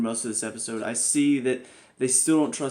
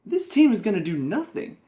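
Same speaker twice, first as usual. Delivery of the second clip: dismissive scoff; 7 semitones higher than usual. Real, not acted. Dismissive verdict on new team.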